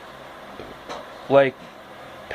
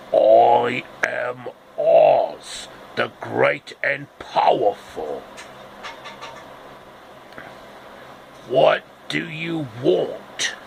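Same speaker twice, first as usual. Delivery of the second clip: slow, grand wizard voice; booming wizard; slower than usual. Acted. The Wizard greeting the travelers, slow and grand. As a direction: announce yourself as the mighty Oz, slowly.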